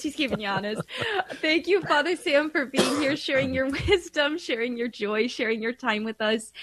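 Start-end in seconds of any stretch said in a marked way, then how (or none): 0.0-0.8 softer repeat through a laugh. Real, not acted. Host repeating the tease to the priest, softly laughing. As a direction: say it again half to yourself, giggling.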